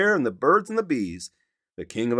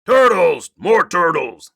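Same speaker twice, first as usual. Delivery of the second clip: loud bellowed orders, higher; greedy king bellowing for more turtles; 5 semitones higher, 8 dB louder than usual. Acted. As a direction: bray the orders down the pond. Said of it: "he bellowed and brayed".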